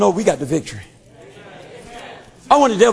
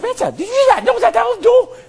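much higher, jumpy little demon voice; startled demon; 12 semitones higher than usual. Acted. A demon asks Satan about the knock, high and startled. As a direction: squeak it out like a spooked imp.

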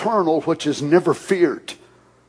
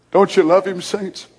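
normal talking voice

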